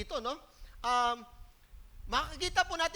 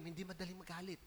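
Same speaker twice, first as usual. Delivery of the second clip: "Hindi madaling magalit" said much softer to the person beside you; soft spoken to neighbor; 8 semitones lower, 13 dB quieter than usual. Shown as a demonstration. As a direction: murmur it to your neighbor. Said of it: "O, sabi mo sa katabi". (mahinang sinasabi sa katabi)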